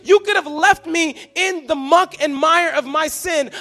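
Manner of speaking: loud, high, overjoyed cry; excited shouting. Pretend. Preacher voicing himself arriving in heaven, shouting with joy. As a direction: shout your gratitude upward. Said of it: "so excited and so giddy to say".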